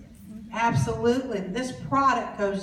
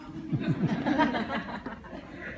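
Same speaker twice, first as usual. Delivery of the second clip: laughing; 5 dB quieter than usual. Real, not acted. Laughing at story.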